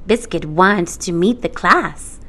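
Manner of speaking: ordinary delivery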